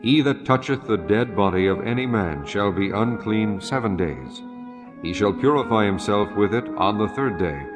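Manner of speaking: ordinary talk, nothing marked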